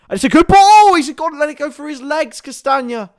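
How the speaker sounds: loud, high-pitched cry as the chance opens up; excited commentary. Real, not acted. Sudden chance in live match commentary.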